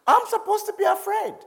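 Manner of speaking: high, mock-indignant voice with a laugh; scared objector. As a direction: play the panicked listener pushing back. Mocking a frightened listener's retort.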